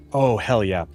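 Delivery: ordinary talk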